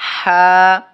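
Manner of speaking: হা called out loud; emphatic ha. Shown as a demonstration. Teacher sounding হা for students to repeat. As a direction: sing out হা clearly. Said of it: "কি হবে?".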